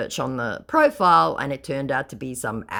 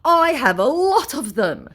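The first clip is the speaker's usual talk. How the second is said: much higher put-on boasting voice; mock scammer; 12 semitones higher, 5 dB louder than usual. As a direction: boast like a squeaky conman. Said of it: "he goes".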